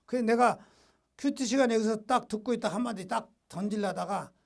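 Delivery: ordinary talk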